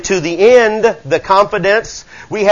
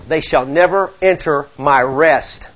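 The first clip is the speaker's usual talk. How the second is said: solemn reading; 6 semitones lower, 4 dB quieter, slower than usual. Shown as a demonstration. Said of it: "repeated slower".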